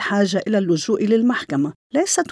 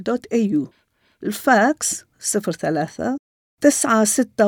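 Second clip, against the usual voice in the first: slow dictation; slower than usual. Real, not acted. Dictating contact details slowly.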